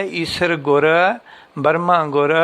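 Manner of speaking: usual speaking voice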